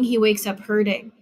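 plain reading voice

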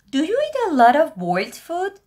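high, bright-toned question voice; curious asker. Acted. Teacher voicing a friend who asks about food, high and curious. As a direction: voice a second speaker asking.

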